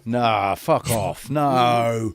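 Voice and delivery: mock angry flat earther. Mocking a flat earther at video end.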